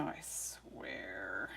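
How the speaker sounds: exasperated whisper. Real, not acted. Streamer fighting her phone, whispering in exasperation. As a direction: breathe it out, fed up with the phone. Tech failing again, exasperated.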